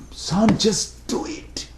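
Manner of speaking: restrained, drawn-out anger at a son; angry father. Acted. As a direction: act out a father at the end of his patience. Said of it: "he became so angry with his son".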